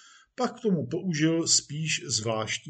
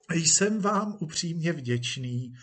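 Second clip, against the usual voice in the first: slow, higher, obliging thanks; deferential actor; 4 semitones higher than usual. Acted. Actor thanks police chief, deferentially. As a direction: thank a patron with careful respect.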